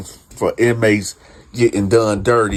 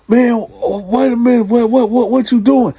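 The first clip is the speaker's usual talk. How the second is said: startled cellmate; 13 semitones higher, 4 dB louder than usual. Retold or imitated. Startled cellmate answers back.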